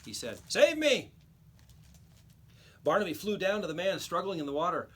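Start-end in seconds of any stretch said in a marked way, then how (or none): 0.5-1.1 high, loud desperate plea; man overboard cries for rescue; drowning man pleading. A narrator's reading. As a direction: beg to be pulled from the sea.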